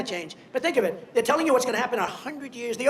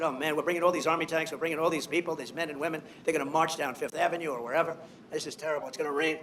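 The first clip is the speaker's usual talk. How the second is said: mock worried mutter; 6 semitones lower, 7 dB quieter than usual. Retelling his worried reaction.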